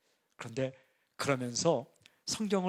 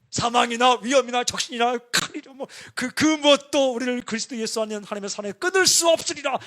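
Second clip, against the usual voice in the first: loud, raised, fervent scripture reading; excited shouting; 7 semitones higher, 11 dB louder than usual. Retold or imitated. Pastor recalls shouting Romans alone while recording, excited. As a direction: proclaim it as if you can't contain yourself. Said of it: "혼자 흥분해서, 혼자 흥분해서 막 소리쳤어요".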